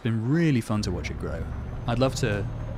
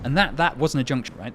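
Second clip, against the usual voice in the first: defensive; 3 semitones higher, 5 dB louder than usual. Real, not acted. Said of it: "I'm getting so defensive".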